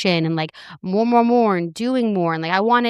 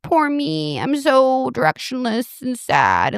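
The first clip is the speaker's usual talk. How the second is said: slow whiny mock victim, pitched higher; self-pity whine; 3 semitones higher, slower than usual. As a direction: ham up poor-me self-pity. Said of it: "And I'm like".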